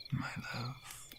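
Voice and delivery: barely voiced, very soft my love; whispered endearment. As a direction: breathe it out. Tender whisper.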